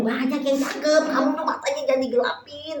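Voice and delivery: high-pitched motherly protest; exasperated retort. Retold or imitated. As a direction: argue back, pitched well up. Mother retorts to daughter's excuse.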